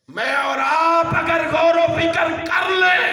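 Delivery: high, loud, ringing sermon shout; impassioned preacher's shout, pitched high; full-force preaching. Real, not acted. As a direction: preach it out loud to the whole congregation.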